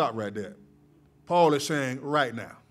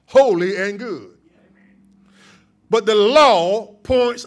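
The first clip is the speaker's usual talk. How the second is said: louder, higher preaching cry, each phrase hammered; rising pulpit fervor, loud and ringing; full-force preaching; 4 semitones higher, 10 dB louder than usual. Acted. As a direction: lift the church, proclaim it.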